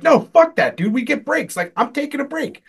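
high-pitched indignant refusal, retold; defiant retort. A worker refuses coworkers' push to keep going, high and indignant. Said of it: "And I'm like".

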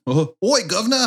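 high, hammed-up London copper accent; London policeman accent. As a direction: bark oi governor in a mock London accent. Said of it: "the accent a little bit".

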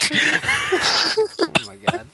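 drawn-out laughing exclamation. Real, not acted. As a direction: laugh through the words. Reacting while laughing.

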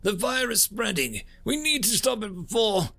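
high-pitched alarm; frantic crewman to his captain; urgent crew report. A narrator's reading. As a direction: report in rising panic as the fire spreads. Crewman's urgent report as fire spreads.

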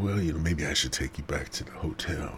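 whispered, sly offer; hushed suggestion. Retold or imitated. Suggesting to the woman he take her back to the hotel, whispered. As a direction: breathe it to her like a secret.

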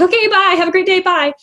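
high nervous goodbye while backing away; awkward quick goodbye. Pretend. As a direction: sing it out brightly to escape an embarrassing moment. Awkward retreat after wrong guess.

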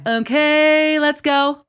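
put-on high, loud sing-out in a kid's voice; imitating daughter. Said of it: "my daughter ... she's like".

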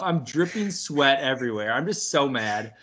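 usual speaking voice

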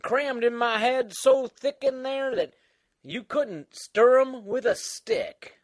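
put-on creaky old-timer voice; Old Man Dan. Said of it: "All right, Old Man Dan".

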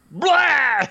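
loud, high-pitched mock retch; sudden comic "blah" of puking; imitated vomiting. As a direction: act out a kid suddenly throwing up. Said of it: "and then throws up all over".

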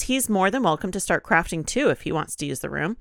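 usual speaking voice

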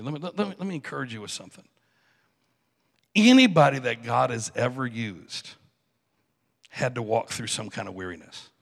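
ordinary talk, nothing marked

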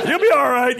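high-pitched breezy reassurance; joking brush-off. Retold or imitated. Joking brush-off to injured son.

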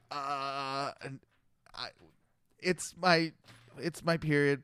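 halting, with long pauses; flustered stammer. Retold or imitated. Woman fumbling an excuse to the cop, flustered stammer.

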